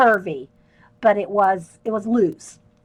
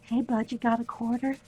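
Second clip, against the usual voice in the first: soft repeat; 9 dB quieter than usual. Retold or imitated. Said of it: "repeats the line laughing".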